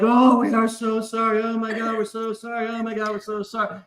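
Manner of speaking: gushing, over-the-top apology; hammed-up sorry from the drunk girls; mock apologetic girls. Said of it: "they're like".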